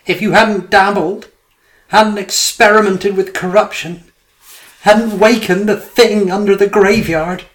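high, loud anguished cry; inner voice crying out. A narrator's reading. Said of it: "Something within him was crying out".

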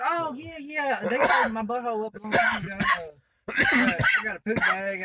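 high, put-on woman's voice, casual; high voice for Diane. Retelling the ex's casual confession in a high put-on woman's voice. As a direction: squeak it out like an older lady shrugging it off.

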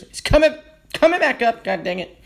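words pushed out through a burp; burping while talking. Real, not acted. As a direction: talk through a rising burp. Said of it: "Sorry, I'm burping here".

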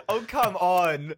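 loud, high, drawn-out objection; laughing protest. Real, not acted. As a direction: protest loudly and slowly through laughter. Protests at a joke while laughing.

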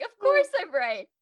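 cocky told-you-so; smug. Retold or imitated. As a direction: gloat a little. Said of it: "I was like".

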